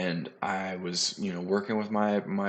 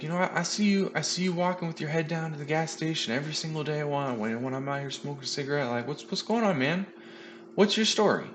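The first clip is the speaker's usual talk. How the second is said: higher, concerned voice of a kindly stranger; imitating the trainer; 8 semitones higher than usual. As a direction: reach out gently in a raised imitated voice. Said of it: "he's like".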